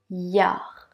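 "Yard" ends on the R, the D left silent. Shown as a demonstration. Teacher to learner isolating the last syllable, D dropped. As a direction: say "Yard" with no D at the end. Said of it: "on a le son A et R, car le D ne se prononce pas".

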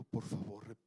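soft, near-crying whispered plea; whispered plea to the young people, close to tears; pained whisper. Retold or imitated. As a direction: plead gently, almost in tears. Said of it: "con dolor y casi llorando le digo".

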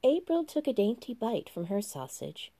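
ordinary delivery

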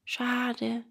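soft, regretful word; disappointed. A narrator's reading. Boy learns it leaves right away, soft disappointment. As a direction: let it out quietly with regret.

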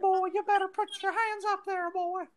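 very high falsetto mouse playing a cowboy; cartoon-mouse Western impression; Mickey Mouse cowboy. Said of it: "Western accent with a Mickey Mouse voice".

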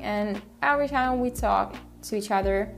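usual speaking voice

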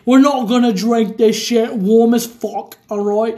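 put-on drawn-out hammy Peaky Blinders British accent; exaggerated British gangster impression. Drinker doing Peaky Blinders impression.

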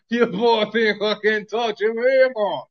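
loud, high, drawn-out Jamaican put-on; Jamaican accent. Host repeats the boast for laughs, hammed-up loud Jamaican accent. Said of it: "Give me a Jamaican accent".

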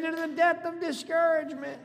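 high, fluent preacher's cry; rallying cry. Shown as a demonstration. Pastor getting congregation to repeat the slogan, high rallying cry. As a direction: raise the pitch and deliver it in one run. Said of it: "Say it again".

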